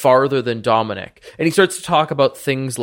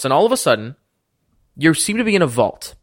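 normal talking voice

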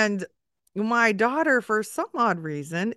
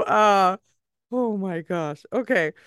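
usual speaking voice

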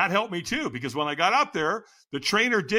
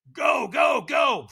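hyped shout; 8 semitones higher, 3 dB louder, much slower than usual. Retold or imitated. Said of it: "hyping himself up".

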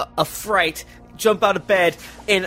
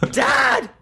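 hammed-up cry; 5 semitones higher than usual. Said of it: "I'm not good at drama".

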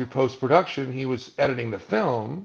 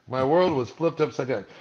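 usual speaking voice

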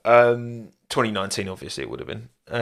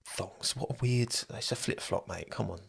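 mock aside; 14 dB quieter than usual. Mocking Aussie thongs, quiet aside.

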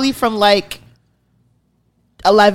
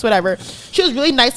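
normal talking voice

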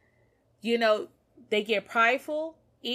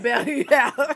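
laughing quote; 5 semitones higher, 5 dB louder, slower than usual. Retold or imitated. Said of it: "He said".

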